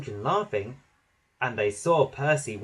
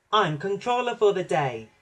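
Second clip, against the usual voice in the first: higher-pitched proud little engine; proud peep; 6 semitones higher than usual. A narrator's reading. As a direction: announce your new job proudly. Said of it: "peeped Percy proudly".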